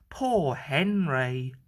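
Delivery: plain reading voice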